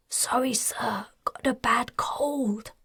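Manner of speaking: hoarse, whispery excuse. Acted. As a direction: fake a sore throat, rasping. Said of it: "said hoarsely".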